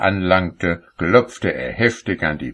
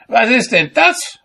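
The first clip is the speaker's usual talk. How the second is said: high, loud fault-finding; indignant call; 4 dB louder than usual. A narrator's reading. Cobbler berates the carpenters, high-pitched indignant shouting. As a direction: challenge them at a very high pitch. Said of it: "rief er".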